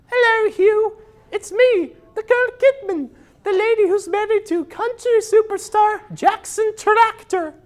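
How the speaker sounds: very high falsetto lady voice; Nicole Kidman. Acted. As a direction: squeak it out like a prim movie star. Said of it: "I will reenact the scene for you".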